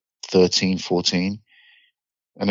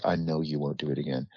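softer, lower, deadly calm; quiet cold threat; 3 semitones lower, 8 dB quieter than usual. Retold or imitated. Teller answering the pleading kid, soft and menacing. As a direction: say it low and icy, no need to shout.

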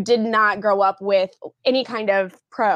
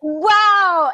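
loud, high-pitched wow; raised, emphatic exclamation; exclaimed wow; 9 semitones higher, 7 dB louder than usual. Real, not acted. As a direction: react big to an outrageous line. Co-host reacts to mock outburst.

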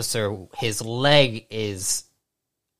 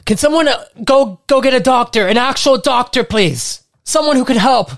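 much higher, louder plea for a doctor; desperate medic shout; 13 semitones higher, 6 dB louder than usual. Acted. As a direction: medic calling out for a real doctor. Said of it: "You hear the medic say".

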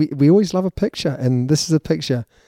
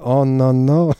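a mock French accent, lightly put on; a half-hearted French accent; terrible French accent; slower than usual. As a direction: toss it off in a bad French accent, as a joke. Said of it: "That is a terrible French accent".

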